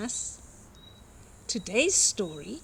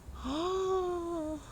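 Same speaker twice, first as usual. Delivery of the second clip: gasp; 8 semitones higher, 7 dB quieter than usual. Acted. Reader reacts to the trucks.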